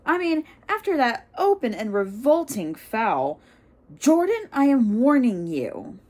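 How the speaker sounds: stern warning. Acted. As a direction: warn him off sharply. McGonagall warning, Lee indignant.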